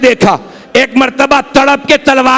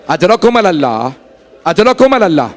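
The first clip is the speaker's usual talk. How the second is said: normal talking voice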